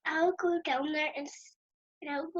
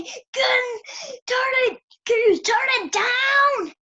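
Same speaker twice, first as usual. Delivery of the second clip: shouted, much higher and louder, slowing on the last words; mock yell at roommate; 11 semitones higher, 10 dB louder, much slower than usual. Child yelling at loud roommate to turn TV down, a full shout.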